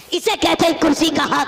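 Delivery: loud, emphatic declaration; loud pulpit punchline; fiery preaching. Acted. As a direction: declare it to the crowd. Preaching at full force.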